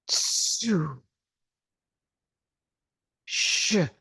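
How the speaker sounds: slow drawn-out exhaled healing sounds, each one separate; six healing sounds. Shown as a demonstration. Teacher leads the class through exhaled healing sounds. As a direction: push each sound out on a long breath, pausing between.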